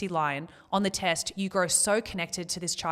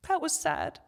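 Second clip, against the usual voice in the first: high voice, close to tears; fighting real tears while speaking; small, high, tearful admission; real choked-up sadness; 7 semitones higher than usual. Real, not acted. Overcome after retelling the farewell.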